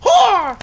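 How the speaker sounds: very high loud yelp; Mario scream. Acted. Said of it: "Mario screamed again".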